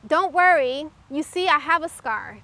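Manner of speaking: lightly voiced three-year-old comforting mom; imitating her little son. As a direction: son reassuring his crying mother, mild child voice. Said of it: "And then he says".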